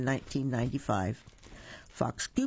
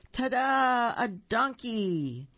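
high, loud, triumphant ta-da; grand salesman reveal; showman flourish; 7 semitones higher, 3 dB louder, slower than usual. A narrator's reading. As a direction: unveil it like a magician. Fox presents the egg.

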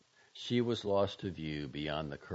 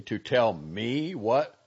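slow, louder, raised challenge; shrill indignation; 5 semitones higher, 9 dB louder, much slower than usual. A narrator's reading. Doctor challenging the girl's tirade, shrill and indignant. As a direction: push the question up and out, indignant. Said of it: "he began shrilly".

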